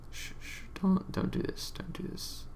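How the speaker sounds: whisper, hushing yourself; frantic little whisper to stop himself; shushing whisper. Real, not acted. Hushing himself after weird noise.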